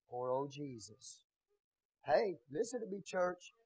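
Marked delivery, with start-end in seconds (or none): 0.1-1.2 mock pitiful. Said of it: "same lifestyle".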